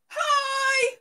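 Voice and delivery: high, loud excited greeting; excited shout. Real, not acted. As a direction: call out a big hello. Greeting viewer joining live.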